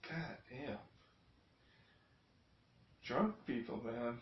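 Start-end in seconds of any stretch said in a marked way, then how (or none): none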